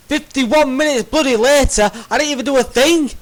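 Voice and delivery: loud, high, fast exasperation; frustrated rant. Real, not acted. Gamer complaining to viewers, loud and fast. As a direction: rant hotly about wasted time.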